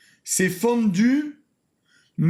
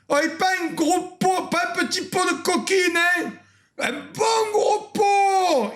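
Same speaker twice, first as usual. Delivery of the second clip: calling out; 8 semitones higher than usual. Real, not acted. Calling out to a friend.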